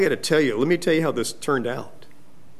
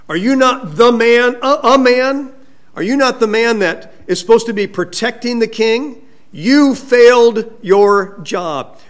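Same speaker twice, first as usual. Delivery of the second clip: raised, higher-pitched accusing taunt; sarcastic scolding; 6 semitones higher, 5 dB louder than usual. Retold or imitated. David rebukes Abner for failing the king, loudly sarcastic. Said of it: "There's a biblical sarcasm".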